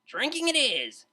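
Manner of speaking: high, slow, loud declaration; declared. A narrator's reading. DJ answering the tutor's suggestion, loud and pleased. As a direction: announce it with relish. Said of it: "declared the D J".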